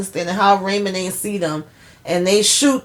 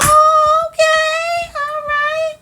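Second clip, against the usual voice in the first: squeaky, much higher and louder surprised "oh, okay"; surprised squeal; 4 dB louder, much slower than usual. Real, not acted. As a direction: squeal it like you can't believe it. Own reaction, squeaky high voice.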